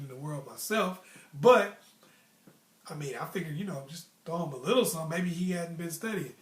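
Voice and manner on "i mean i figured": ordinary talk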